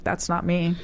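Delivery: ordinary talk, nothing marked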